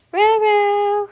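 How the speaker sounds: high, loud call of the puppy's name; excited pet call. Real, not acted. Breeder calling puppy Ronan in a high loud coo.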